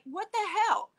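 high exasperated retort; exasperated outburst. Retold or imitated. Wheelchair user venting about being rammed into people, exasperated. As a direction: pitch jumps up in indignation. Retelling outrage at being shoved.

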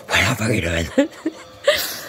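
landlord voice. Retold or imitated. Voicing landlord, laughing.

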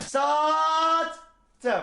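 high, drawn-out sold call; auctioneer. Acted. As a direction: close the sale like an auctioneer. Hammer down. (mezatçı gibi "sattım")

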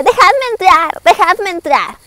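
high-pitched mock cry, no real volume; grito teatral imitado. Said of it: "gritaba y aparecía y decía". (grito de teatro escolar, agudo pero contenido)

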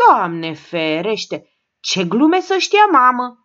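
put-on an old mother aghast, crying out; shocked cry. A narrator's reading. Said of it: "o scăpă în oltenească".

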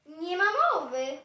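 loud, indignant no; emphatic refusal. Acted. Cat refusing the dog's offer, loud and emphatic. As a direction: belt out a firm refusal.

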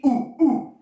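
short, high monkey hoots; high-pitched "ooh ooh" monkey call. Shown as a demonstration. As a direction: hoot twice like a playful monkey. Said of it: "you're gonna act like a monkey".

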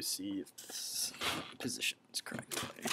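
whispered aside; whispering. Real, not acted. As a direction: whisper under your breath. Aside while setting up the camera.

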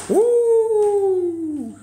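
very high loud whoop; woo yell. Real, not acted. Cowboy host whooping to hype viewers. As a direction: holler it out.

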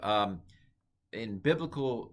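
ordinary delivery

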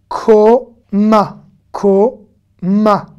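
Koma said loud and long, falling; modeled word. Shown as a demonstration. Teacher modeling a Hebrew word for learners, loud and slow. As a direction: pronounce Koma clearly and drawn out. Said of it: "teacher models word".

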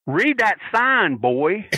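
high, slow, taunting drawl with a hint of a laugh; menacing taunt. Harley Race sneering at Magnum after slamming him, slow taunt. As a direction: sneer it down at the man on the floor.

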